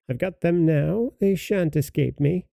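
high, jeering witch voice. A narrator's reading. Witch gloating over the captured children, jeering and high. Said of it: "said jeeringly".

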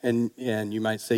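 ordinary talk, nothing marked

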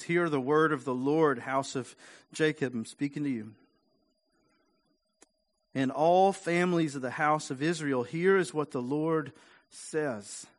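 preaching at full force. Acted.

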